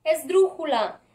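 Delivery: ordinary talk, nothing marked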